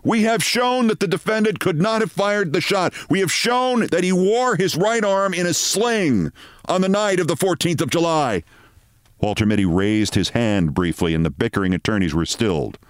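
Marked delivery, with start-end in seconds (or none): 0.0-8.4 attorney pleading. Acted. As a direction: argue it to the jury. Attorney addresses the court.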